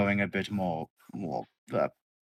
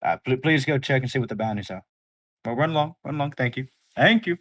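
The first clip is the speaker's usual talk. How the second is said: higher, fast, shooing him off; 6 semitones higher, 5 dB louder, faster than usual. Acted. Thatch hurrying the crewman off, high and fast. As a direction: hurry him along politely.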